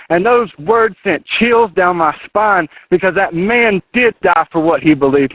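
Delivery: ordinary talk, nothing marked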